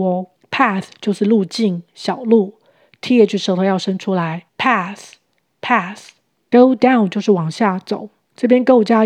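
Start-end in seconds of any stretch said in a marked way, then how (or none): 3.0-6.1 Path with the tongue between the teeth. Shown as a demonstration. Teacher demonstrating Path to learners, tongue out on TH.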